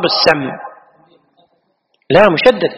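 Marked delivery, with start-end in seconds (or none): 0.0-0.4 "السم" as as-samm; fatha on sin. Shown as a demonstration. Teacher confirming the correct form, "السم" as samm. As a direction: say "السم" with an a and doubled m. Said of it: "الصواب".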